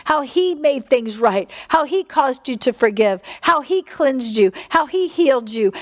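ordinary talk, nothing marked

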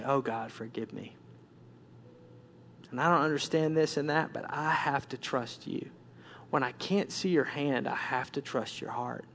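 hushed plea for forgiveness; broken, penitent prayer. Acted. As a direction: confess humbly, almost to yourself. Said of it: "I began to break and just say".

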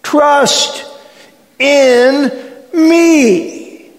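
loud, high cry, one word at a time; slow-building, ringing plea as Jesus; impassioned appeal. Acted. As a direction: proclaim each word separately at full voice.

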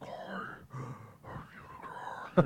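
wheezing, very slow breathy whisper; Orson Welles. Retold or imitated. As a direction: drag it out as if out of lung, mock-feeble. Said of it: "he sounded like he needed, like, a whole new lung".

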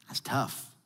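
breathy near-whisper; preacher's hushed aside to the congregation. Real, not acted. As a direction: say it under your breath, heavy. Quiet aside after harsh point.

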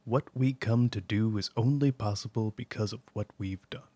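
low, soft, measured commander's address; Zavala. Acted. Commander addressing Guardians at Solstice, low and measured. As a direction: deliver it as a solemn commander, quiet and deliberate. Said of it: "Vanguard Commander Zavala".

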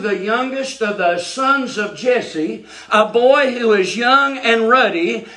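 usual speaking voice

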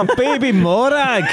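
high, slow cooing with laughter; laughing baby talk. Pretend. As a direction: coo at a newborn, giggling. Cooing at imagined baby Morag.

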